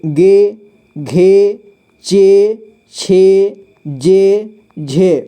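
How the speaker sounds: each e-matra syllable said slowly and clearly, one by one; slow, clear teacher drill of Hindi syllables. Shown as a demonstration. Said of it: "जिसमें भी ए की मात्रा लगा है उसका sound".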